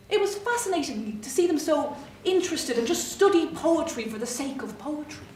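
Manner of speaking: ordinary delivery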